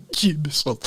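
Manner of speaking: breathy whispered Jameson. Acted. Comic whispering the whiskey's name to the audience.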